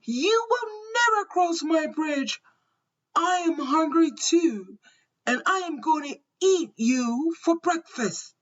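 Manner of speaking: high-pitched storybook troll scream. A narrator's reading. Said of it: "all over as he screamed".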